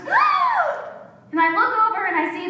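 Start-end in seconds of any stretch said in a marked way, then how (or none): 0.1-0.9 sudden high shriek of alarm; mom's startled cry. Retold or imitated. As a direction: yelp like a car is coming. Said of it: "my mom just goes".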